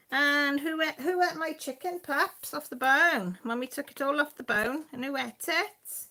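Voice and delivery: high sing-song voice to the dog; high pet talk. Real, not acted. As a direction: playfully scold the pup in a high voice. Teasing the dog about the chicken.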